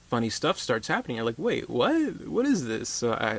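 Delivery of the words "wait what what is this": higher, puzzled double take; surprised. Pretend. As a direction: react like you just caught on. Viewer realizing it's comedy.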